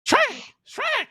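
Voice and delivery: very high-pitched excited Donkey impression. Answering a request for an Eddie Murphy impression, as Donkey. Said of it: "It's just donkey".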